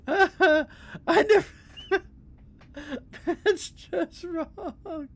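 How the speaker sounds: words squeezed out through high, teary laughter; can barely get it out for laughing; laughing helplessly. Real, not acted. Laughing at her own joke.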